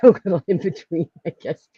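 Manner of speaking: ordinary delivery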